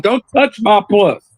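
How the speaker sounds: put-on high, slow stern child; child copying granny. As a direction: mimic granny's warning. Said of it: "they said it just alike".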